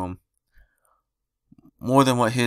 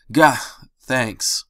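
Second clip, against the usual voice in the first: groggy; 3 semitones higher, 5 dB louder than usual. Acted. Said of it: "in a quiet and groggy voice".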